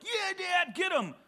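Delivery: high, loud mock cheer; brothers egging on. Brothers urging dad on, mock high cheering. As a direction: cheer him on like a gleeful brother. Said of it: "my brothers, who usually are like".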